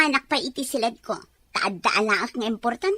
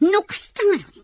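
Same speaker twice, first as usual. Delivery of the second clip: crying; 7 semitones higher than usual. Acted. Wife breaking down in quarrel.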